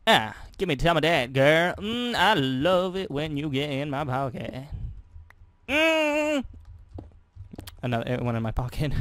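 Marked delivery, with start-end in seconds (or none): 5.7-6.4 mock pleasure moan. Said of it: "supposed to be sounds of pleasure".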